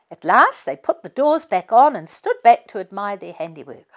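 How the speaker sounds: ordinary delivery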